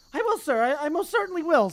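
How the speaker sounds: high, loud oily apology; greasy grovelling poltergeist. Acted. Peeves grovelling before the Bloody Baron, high loud oily voice.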